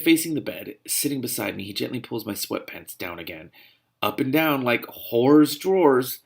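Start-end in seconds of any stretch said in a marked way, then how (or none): 4.0-6.2 slow, sour aside; bitter subconscious. Acted. Subconscious sneering slowly at the heroine. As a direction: drawl it with spite. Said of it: "My subconscious remarks bitterly".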